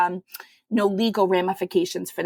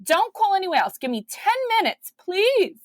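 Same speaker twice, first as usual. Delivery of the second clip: much higher, quick urgent pleading; eager; 11 semitones higher than usual. Retold or imitated. Mother begging the adoption worker for ten minutes, high and urgent. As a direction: rush to hold the baby for yourself.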